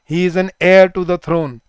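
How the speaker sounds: "heir" stressed, whole line slow; slow dictation. Shown as a demonstration. Teacher dictating with heir stressed. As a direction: hit "heir", silent h. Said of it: "ये जो word होता है hair".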